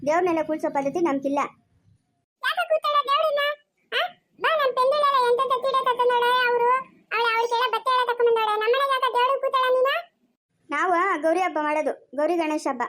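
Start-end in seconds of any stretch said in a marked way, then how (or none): 2.4-9.9 put-on high-pitched child pestering with questions; complaining little child. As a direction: demand answers in a high kid voice. (ದೂರು ಹೇಳುವ ಪುಟ್ಟ ಮಗುವಿನ ಧ್ವನಿ)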